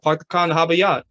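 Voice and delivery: put-on Boston accent with no R's, "Pahk the cah in the hahbah yahd"; the kid's effortless Boston accent, dropping every R. Retelling how he did the accent for friends, Boston accent. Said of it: "You do that way too well".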